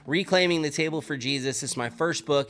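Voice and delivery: soft, sincere promo over music; ad read. Acted. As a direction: pitch the book gently to listeners.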